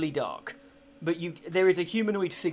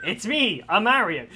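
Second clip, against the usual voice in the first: high, loud Mario voice; Mario catchphrase; 4 semitones higher, 7 dB louder than usual. Retold or imitated. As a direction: burst out as a cartoon Mario. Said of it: "it just went in my head".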